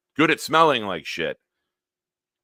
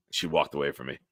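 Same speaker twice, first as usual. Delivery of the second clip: normal talking voice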